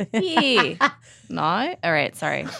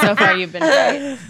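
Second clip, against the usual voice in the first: laughing; 8 semitones higher, 3 dB louder, slower than usual. Real, not acted. Host teasing while laughing.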